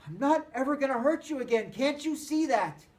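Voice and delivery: loud high plea, slowing on the question; pleading shout. Acted. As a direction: beg him to understand. Cole pleading while hit.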